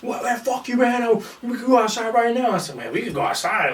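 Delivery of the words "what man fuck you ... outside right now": high-pitched imitation of an angry challenger; mock angry challenge. Opponent challenges him to a fight, high mock anger. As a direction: blurt it high, mocking a hothead.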